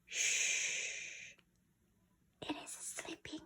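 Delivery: unvoiced "Shh, it is sleeping"; hushing whisper. Shown as a demonstration. Teacher modelling a whisper for a sleeping dormouse. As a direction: hush them so the mouse sleeps on. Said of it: "we say".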